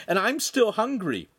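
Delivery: high-pitched indignant complaint; angry. A narrator's reading. Lincoln complains to the Podesta, angry. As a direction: complain with an aggrieved edge. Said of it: "Lincoln said angrily".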